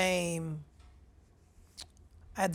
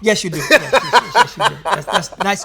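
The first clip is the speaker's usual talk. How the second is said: laughing; 10 dB louder than usual. Real, not acted. Teasing about muscles.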